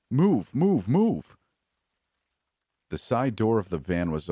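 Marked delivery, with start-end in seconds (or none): 0.1-1.4 loud, high urgent shout. Acted. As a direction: bark orders to hurry everyone. Urgent escape, ordering women out.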